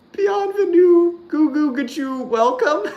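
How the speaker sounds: high, slow, sing-song mockery of a bad performance; mocking bad singer. Streamer mocks a bad Broadway performance, high and hammy. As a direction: camp it up, squeal the welcome like a terrible cabaret MC. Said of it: "it basically goes like".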